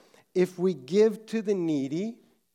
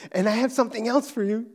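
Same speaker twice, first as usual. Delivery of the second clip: put-on fast, louder eager child voice; retold excited child; 5 semitones higher, 7 dB louder, much faster than usual. Daughter offering daddy the wrapping paper too, excited. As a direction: rush it out with little-girl glee. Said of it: "she said excitedly".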